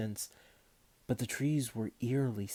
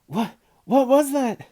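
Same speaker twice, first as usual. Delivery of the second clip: a much higher, much louder, slow frightened shout; 14 semitones higher, 13 dB louder than usual. A narrator's reading. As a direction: yell it out in alarm. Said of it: "and Benjamin shouted".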